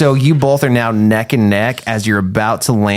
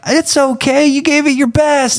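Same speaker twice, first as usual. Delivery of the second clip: very high, soothing sing-out; acted consoling. Townsfolk hugging the losing player, high consoling voice. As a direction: comfort the loser in a high-pitched voice.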